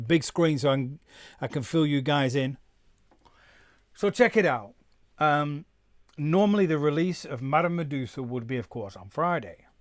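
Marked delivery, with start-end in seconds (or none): none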